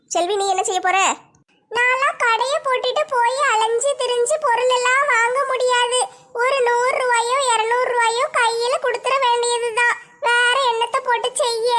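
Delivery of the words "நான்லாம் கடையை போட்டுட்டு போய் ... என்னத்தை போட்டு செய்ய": higher, put-upon shopkeeper's voice; a weary shop woman begging off, pitched high; busy shopkeeper Selvi. Acted. (சலிப்படைந்த கடைக்காரப் பெண்ணின் உயர்ந்த குரல்)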